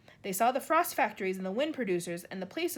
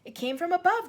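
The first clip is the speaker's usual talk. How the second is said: high, louder alarm; 7 semitones higher, 7 dB louder than usual. A narrator's reading. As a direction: warn them it came from above. Earthworm alarmed by voice.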